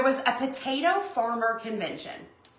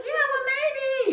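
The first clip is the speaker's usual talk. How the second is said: coy drawn-out maybe; slower than usual. Retold or imitated. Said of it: "I was like".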